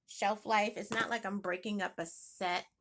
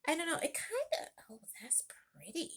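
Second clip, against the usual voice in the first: hushed unvoiced whisper; 7 semitones higher than usual. Real, not acted. Half-admitting to viewers she still likes it, whispered. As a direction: whisper it like a guilty secret. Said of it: "whispered aside".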